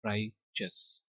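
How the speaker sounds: "राइचस" split as RAI, then chus; simple correct pronunciation. Shown as a demonstration. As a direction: break "राइचस" into two clear syllables. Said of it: "simple". ("राइ-चस" दो हिस्सों में अलग-अलग)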